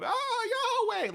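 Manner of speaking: a high bleating goat cry to God. Retold or imitated. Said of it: "you can go".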